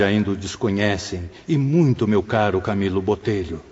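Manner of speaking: ordinary talk, nothing marked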